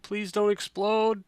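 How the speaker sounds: desperate pleading. Real, not acted. Repeats plea, louder and higher.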